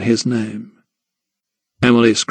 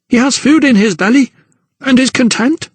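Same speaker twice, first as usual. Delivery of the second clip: much higher mother's voice; mother to Emily, high and defensive; 12 semitones higher, 3 dB louder than usual. Acted. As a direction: insist he's fed.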